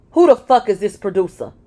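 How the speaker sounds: loud jealous demand; angry. Acted. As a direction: snap the question in rage. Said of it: "He glared at me and asked".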